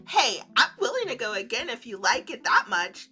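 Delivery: usual speaking voice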